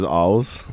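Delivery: "alls" with the R swallowed into an L; R's as alls. Shown as a demonstration. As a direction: demonstrate R's coming out as "alls". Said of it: "our R's is alls".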